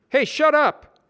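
high, loud snap; annoyed. Acted. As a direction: snap back at him. Brad retorts.

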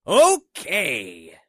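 a loud, high, bold okay; said loudly. A narrator's reading. Kimu loudly accepting Yuki's dare. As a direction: announce it loudly, taking the bait. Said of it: "Kimu said loudly".

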